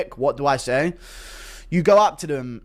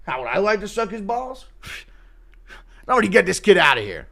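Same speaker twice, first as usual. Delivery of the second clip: indignant host; 6 semitones higher than usual. Acted. Acts Dana's indignant reaction.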